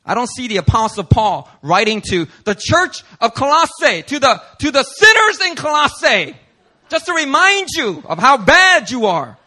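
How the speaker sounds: high, loud, rising preacher's cry; preaching loud. Real, not acted. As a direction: lift it up and drive it home.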